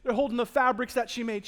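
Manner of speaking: normal talking voice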